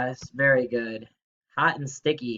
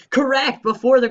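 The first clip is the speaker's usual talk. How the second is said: high, loud correct; high loud cheer for a student; excited praise; 10 semitones higher, 8 dB louder than usual. Real, not acted. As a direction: cheer the right answer. Praising right answer.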